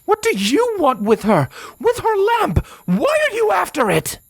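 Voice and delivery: high, frantic angry questioning; heated outburst, voice leaping very high; barely restrained demand. Acted. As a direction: fire off questions, barely holding back. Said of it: "barely holding herself back".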